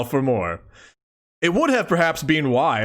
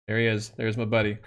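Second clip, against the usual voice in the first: quiet, tender talk to a pet; soft cooing; 5 semitones lower, 5 dB quieter, faster than usual. Real, not acted. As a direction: coo softly at the cat. Cooing at the cat.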